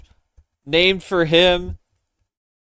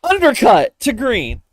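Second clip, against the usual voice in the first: excited call; 12 semitones higher, 7 dB louder than usual. Acted. Mock commentary of card game.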